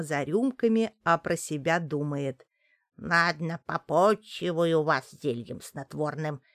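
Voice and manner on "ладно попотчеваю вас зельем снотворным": scheming witch. Acted. Witch plots poisoning.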